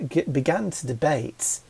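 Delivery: ordinary delivery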